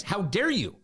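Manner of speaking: imitating a haughty rebuke; indignant scolding. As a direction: scold him as Obama, finger wagging. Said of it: "Obama wagged his finger in his face".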